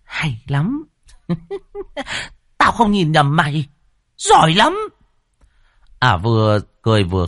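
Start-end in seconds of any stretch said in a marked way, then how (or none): 0.0-0.8 sinister woman voice. Acted. Evil woman praises the madman. 1.3-2.3 high shrill witch cackle; shrieking laugh. Acted. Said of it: "đàn bà cười ré lên". 2.6-4.8 high, gloating evil woman; gloating witch voice. Acted. Evil woman praises the madman.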